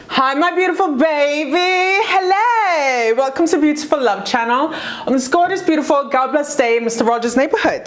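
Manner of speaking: high sweet YouTube intro; channel host greeting. Acted. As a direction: greet the audience like a sunny host.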